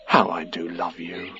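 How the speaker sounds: very soft, low and loving; tender. A narrator's reading. Christopher Robin to Pooh, tender. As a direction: murmur it with deep affection.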